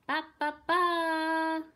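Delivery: loud, high, drawn-out trumpet call; blaring trumpet imitation. A narrator's reading. Trumpet announcing the king from afar, blaring fanfare. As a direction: sound a fanfare with the voice, last note held. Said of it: "a trumpet blared in the distance".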